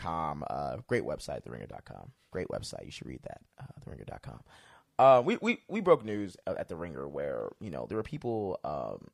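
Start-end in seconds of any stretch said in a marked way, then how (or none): 0.5-4.4 low whispered self-plug; muttered plug aside. Acted. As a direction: drop to a sly half whisper for the plug.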